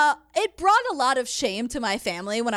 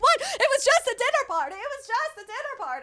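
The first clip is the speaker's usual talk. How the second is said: high, put-upon "just a dinner party"; mock innocent protest; 10 semitones higher than usual. Impersonating her to the co-host, mock innocent. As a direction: play the wronged hostess, all innocence. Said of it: "And she's like".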